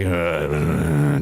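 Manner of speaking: low, drawn-out grumble; long low grunt of reluctance; gruff reluctant grunt. Retold or imitated. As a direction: imitate a standoffish cowboy's low groan. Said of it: "and he's like".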